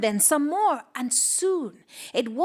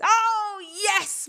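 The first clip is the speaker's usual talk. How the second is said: joyful cheer; 8 semitones higher than usual. Acted. Man's joy at agreement.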